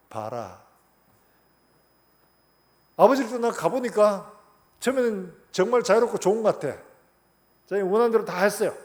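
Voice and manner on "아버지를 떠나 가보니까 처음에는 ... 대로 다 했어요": raised, louder sermon voice; full-force pulpit delivery; fervent preaching. Acted. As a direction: preach it out with fire.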